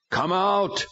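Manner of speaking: a high, loud group command. A narrator's reading. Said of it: "all four commanded".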